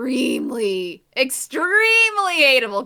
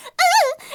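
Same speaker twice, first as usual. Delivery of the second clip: high, loud startled squeak mimicking a shy girl; a mock scared squeal; timid squeak; 7 dB louder than usual. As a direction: yelp like a frightened toddler. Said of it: "She's like".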